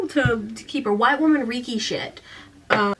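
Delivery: usual speaking voice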